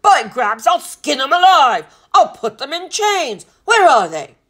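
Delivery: high, loud outraged captain; thundering sea-captain rage; roaring fury. A narrator's reading. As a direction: explode into blustering threats. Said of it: "he roared".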